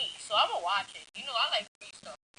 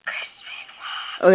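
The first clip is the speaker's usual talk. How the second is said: whisper; 9 dB louder than usual. Real, not acted. Whispering forbidden name.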